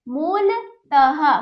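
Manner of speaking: मूलतः drawn out very slowly, the final "ः" sounded as an echoing "हा"; slow, careful teacher model; slow visarga demo. Shown as a demonstration. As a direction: spell out मूलतः slowly, ending on "taha". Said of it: "पढ़िए और समझिए".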